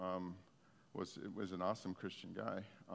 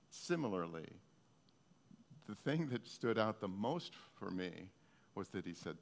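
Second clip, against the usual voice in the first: ordinary delivery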